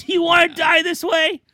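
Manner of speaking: high, loud mock yell at brother; shouting sibling, played for laughs. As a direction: yell it in hammed-up desperation. Acted sibling quarrel.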